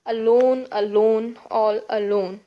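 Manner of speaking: "alone, alone, all alone" with loud stress on each second syllable; loud, measured demo stressing the end of "alone"; stressed word endings. Shown as a demonstration. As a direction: punch the "lone" in every "alone". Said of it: "The stress by, by the end of each word".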